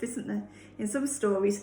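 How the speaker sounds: plain reading voice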